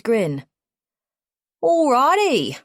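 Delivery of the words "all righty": breezy. A narrator's reading. Cheery Australian guide answers.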